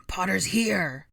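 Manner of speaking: slow, drawn-out shouted alarm; loud villain's call; loud proclamation. A narrator's reading. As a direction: proclaim it to your allies. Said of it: "Rabaston proclaimed".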